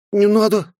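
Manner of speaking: put-on high loud boy's plea; scared child begging; frightened. A narrator's reading. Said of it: "испугался Фил". (испуганный ребёнок)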